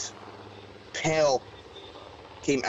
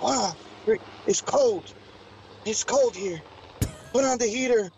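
much higher, put-on alien voice complaining of cold; Nordic aliens complaining of cold; 9 semitones higher than usual. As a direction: whine about the cold like a visiting alien. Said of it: "They're like".

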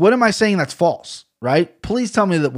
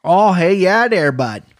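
put-on Canadian accent, higher then dropping on "bud"; 4 semitones higher, 3 dB louder, slower than usual. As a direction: have another go at a mock Canadian greeting. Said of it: "That was a little better".

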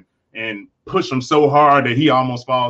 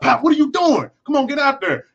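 coach shouting at player; 13 semitones higher than usual. Retold or imitated. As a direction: bark it like a coach firing up a player. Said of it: "they might slap you on your shoulder pad".

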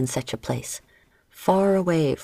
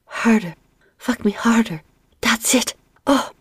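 high, louder breathless cries; panting; 7 semitones higher, 3 dB louder than usual. Acted. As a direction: pant it out mid-passion. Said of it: "Marie Anne panted".

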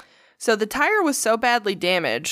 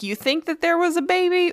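mocking challenge; 9 semitones higher than usual. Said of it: "So Pete's like".

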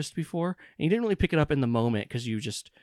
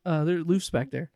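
usual speaking voice

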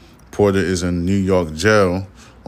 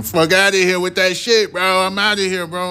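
much higher, hammed-up voice brushing her off; high mock retort; 10 semitones higher than usual. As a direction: squeak out a cocky exit line.

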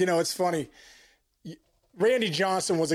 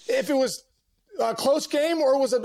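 normal talking voice

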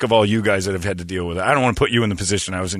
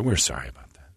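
whispered fake sorry; hushed mock customer-service apology; 10 semitones lower, 10 dB quieter than usual. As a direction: breathe the apology out like a hushed rep. Mocking the company's apology.

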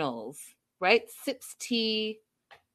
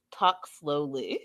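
low, slow mock translator; imitating a measured, slow instruction; 7 semitones lower, 4 dB quieter, slower than usual. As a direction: draw it out slowly and low. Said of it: "And the translator says".

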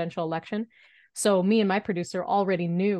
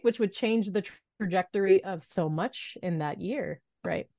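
normal talking voice

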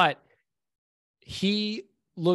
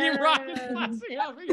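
Team Rocket voice; 10 semitones higher, faster than usual. Acted. Quoting cartoon villains flying off.

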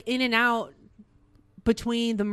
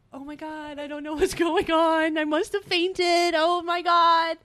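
dazed disbelief; 6 semitones higher than usual. Retold or imitated. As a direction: imitate coming to, starstruck and flustered. Acting out coming to.

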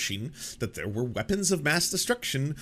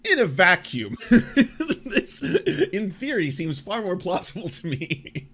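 laughing; 7 semitones higher, 5 dB louder than usual. Real, not acted. Mocking the argument while laughing.

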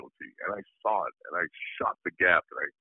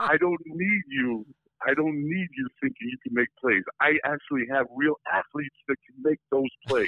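mocking coach; 8 semitones higher than usual. Coach ripping his linebacker on the bench, high mocking voice. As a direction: sneer it like a coach cutting a rookie down.

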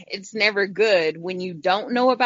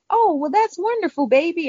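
much higher, sweet doting grandma; grandmother, a high sweet tone; grandma's sweet voice; 10 semitones higher than usual. Retold or imitated. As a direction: coo it like a sweet old grandma. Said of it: "she was like".

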